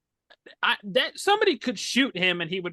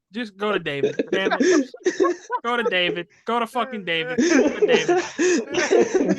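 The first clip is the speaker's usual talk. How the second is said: echoing intercom voice; flat echoey pa page; 5 semitones higher, 5 dB louder than usual. Real, not acted. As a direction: sounds like a tinny public address speaker. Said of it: "You sound like you're on the intercom system".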